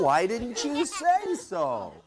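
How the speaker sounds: ordinary talk, nothing marked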